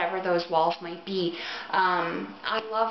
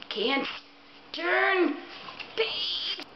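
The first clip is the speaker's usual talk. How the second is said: mock anguish; 10 semitones higher, much slower than usual. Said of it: "I was like".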